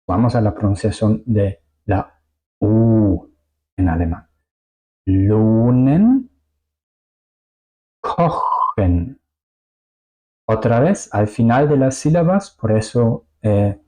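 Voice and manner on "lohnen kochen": Lohnen and kochen drawn out slowly; long vowels. Shown as a demonstration. Teacher models words with long vowels, long vowels. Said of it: "las vocales suenan largas".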